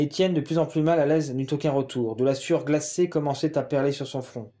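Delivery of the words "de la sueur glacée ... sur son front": ordinary delivery, nothing marked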